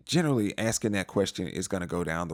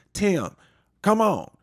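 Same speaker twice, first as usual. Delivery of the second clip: exasperated call; 11 semitones higher, 4 dB louder, much slower than usual. Retold or imitated. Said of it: "sounded like she was mad at everybody".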